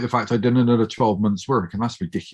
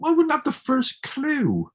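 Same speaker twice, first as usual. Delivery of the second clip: high self-deprecating protest. Retold or imitated. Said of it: "I said".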